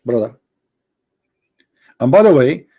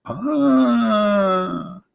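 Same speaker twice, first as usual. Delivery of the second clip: mock wail; 4 semitones higher, 6 dB quieter than usual. Impersonated preacher cries out.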